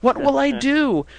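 slow mock lament; mock despair. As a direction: whine it out slowly like doom. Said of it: "And it's like".

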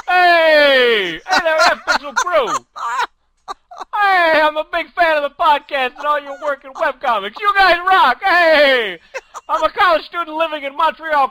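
very loud, high taxi driver shout. Pretend. Reading a fan's question as the taxi driver, shouted. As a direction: holler it like a hammed-up cabbie. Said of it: "I wanna hear it as the taxi driver".

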